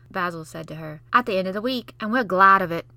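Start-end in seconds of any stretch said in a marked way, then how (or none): 1.1-2.9 smug louder taunt. Acted. Basil gloating to Mary, taunting. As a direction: gloat at her.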